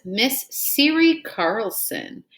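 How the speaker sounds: each syllable drawn out for the class; slow careful reading. Shown as a demonstration. Teacher to pupils, modelling a new name slowly. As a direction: read the name out slowly so children can follow.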